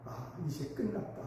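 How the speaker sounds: very soft, resigned sigh; despairing lament. Retold or imitated. Father staring at the ashes of his house, softly despairing. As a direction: let it drop to a hushed sigh. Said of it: "탄식하면서".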